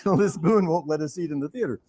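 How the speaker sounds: ordinary talk, nothing marked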